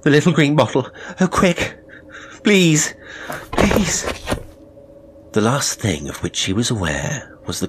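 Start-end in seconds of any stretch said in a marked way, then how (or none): 0.0-1.8 high, loud, urgent begging; desperate plea. Acted. Sick woman begging for medicine. 2.4-2.9 high, loud pleading please; desperate plea. Acted. Sick woman begging for medicine. 3.5-4.0 even higher pleading Please, fading; a last high, desperate Please; pleading again, higher. Acted. Sick woman begging for medicine.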